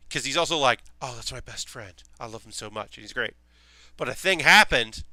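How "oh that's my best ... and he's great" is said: mumbled softly under the breath, much softer; quiet mumble. Retold or imitated. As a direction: mumble it like the shy goth barely audible. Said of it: "He mumbles quietly".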